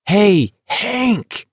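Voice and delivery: high, drawn-out hail; shouting. A narrator's reading. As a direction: call out, pitched well up. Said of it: "McVries shouted, ignoring Baker".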